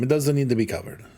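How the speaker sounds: ordinary delivery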